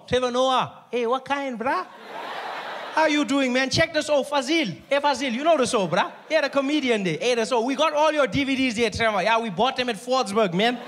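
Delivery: chummy fan calling out, bruh; Indian accent. Retold or imitated. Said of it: "I met a group of Indian guys".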